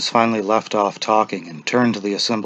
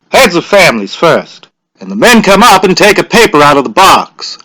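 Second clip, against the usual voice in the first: loud, higher, measured instructions; official giving directions; 8 semitones higher, 10 dB louder than usual. A narrator's reading. Official instructs the villagers, loud and measured. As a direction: lay down the rules to the crowd.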